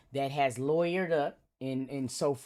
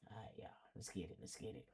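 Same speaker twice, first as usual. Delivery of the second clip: hushed, softer under-the-breath prep; whisper; 5 semitones lower, 23 dB quieter, faster than usual. Real, not acted. Vlogger gets ready to record, whispering under his breath. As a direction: whisper it to yourself before going live. Settles in before starting the video.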